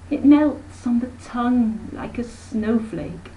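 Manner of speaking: high, bright-voiced busy aunt rattling on; a breezy society lady who never stops talking; breezy chattering aunt. Aunt Emma chatters to her sister, airy and high.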